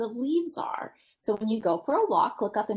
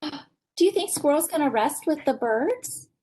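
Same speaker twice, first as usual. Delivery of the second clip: ordinary delivery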